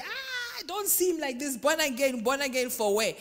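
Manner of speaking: high scoffing "Ah" then loud dismissive jeer; mocking scoff. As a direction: sneer at the born-again talk, loudly. Mocking a scornful churchgoer.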